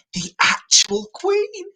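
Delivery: high, exaggerated correction; emphatic correction. Storyteller corrects the drag queen joke emphatically. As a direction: stress the real Queen playfully.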